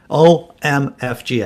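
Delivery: can't believe I forgot to tell you; excited exclamation. Real, not acted. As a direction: spell it out, each letter bursting with news. Excited about untold news.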